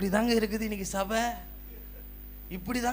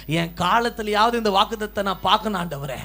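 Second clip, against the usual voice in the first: building, louder petition to the Lord; fervent prayer; 6 semitones higher, 11 dB louder than usual. Acted. Preacher voicing a believer's prayer to God, building fervour. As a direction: pray it out, climbing each time.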